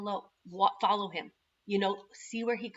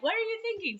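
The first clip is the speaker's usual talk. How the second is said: husband scolding; 13 semitones higher, faster than usual. Retold or imitated. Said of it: "He would be like".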